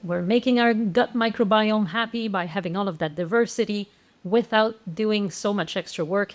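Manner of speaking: usual speaking voice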